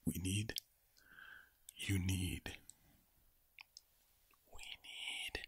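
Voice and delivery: ordinary delivery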